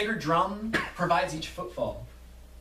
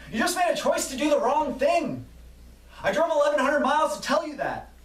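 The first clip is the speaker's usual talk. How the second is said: impassioned yell; 10 semitones higher than usual. Acted. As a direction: urge the workers from the heart. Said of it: "A man sees their hearts and yells".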